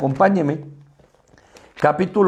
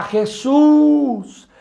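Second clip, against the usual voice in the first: high, pleading shout to Jesus; crying out; 7 semitones higher, slower than usual. Retold or imitated. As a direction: call out desperately from the roadside. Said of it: "ese hombre gritaba".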